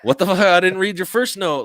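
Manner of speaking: high, loud protest as the messenger; flustered messenger. Acted. Messenger protesting to the man who yelled at him, flustered. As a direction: push the voice up high, defensively pleading ignorance.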